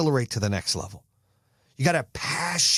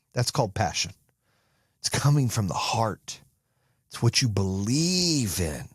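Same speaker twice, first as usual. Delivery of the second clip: ordinary delivery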